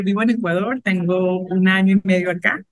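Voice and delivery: ordinary talk, nothing marked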